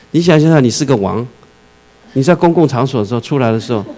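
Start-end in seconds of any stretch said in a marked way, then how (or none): none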